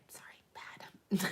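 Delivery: breathy whispered apology; sorry, barely voiced. Real, not acted. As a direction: drop to a sheepish whisper. Sheepish apology after laughing.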